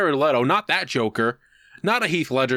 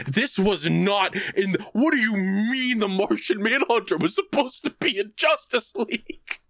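retold scream; 7 semitones higher than usual. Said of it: "literally screaming at the top of their lungs".

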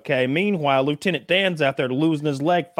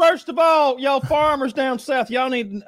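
high-pitched mock Southern accent; 10 semitones higher, slower than usual. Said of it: "giving you the Southern accent".

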